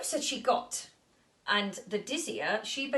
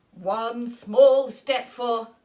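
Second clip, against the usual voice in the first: louder, slow astronaut voice; booming astronaut; 11 dB louder, much slower than usual. Acted. Astronaut steps onto the moon, grand and booming. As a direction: announce it grandly and slowly.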